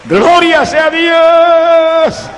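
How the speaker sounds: high, slow, loud cry of praise; praise shout. Real, not acted. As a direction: shout it out to God. Preacher exclaims praise. (grito de alabanza alto y lento)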